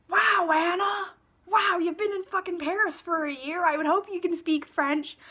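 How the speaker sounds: loud, high mock congratulations; exasperated sarcastic cheer; sarcastic amazement. Mockingly congratulating the fictional heroine.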